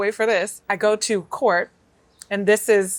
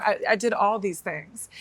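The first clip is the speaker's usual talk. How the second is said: normal talking voice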